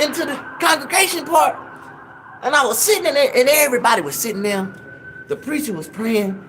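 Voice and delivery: ordinary delivery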